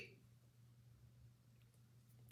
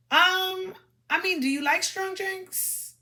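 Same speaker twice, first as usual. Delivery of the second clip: lightly acted man at the bar, higher pitch; a hint of a higher put-on voice for his hesitant reply; man's reply; 8 semitones higher, slower than usual. Man at the bar answers the woman's question, lightly acted in a higher voice.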